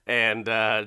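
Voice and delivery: ordinary delivery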